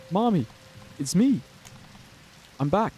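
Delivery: put-on a hopeful child coming home; little girl's voice.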